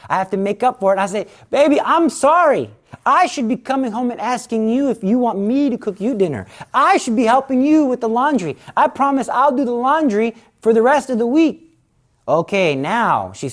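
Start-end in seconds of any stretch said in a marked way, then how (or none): 1.5-11.7 pleading husband. Acted. Husband apologizes.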